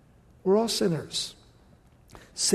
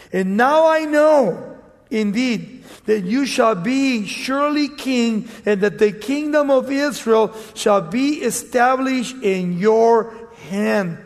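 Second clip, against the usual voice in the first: raised, emphatic Bible reading; scripture reading; 3 semitones higher, 5 dB louder than usual. Acted. Preacher reads scripture to congregation, emphatic and raised. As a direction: read the verse out to the congregation. Rereads verse, slower.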